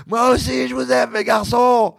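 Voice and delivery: high, slow loving shout; father shouting love to his sons, high and slow; retold yell. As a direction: shout it back up the cliff. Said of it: "J'ai hurlé comme c'est pas possible".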